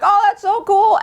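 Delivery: put-on high-pitched admiring child voice; impressed kids. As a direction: gush like an awed kid. Kids impressed by trick-or-treating mom.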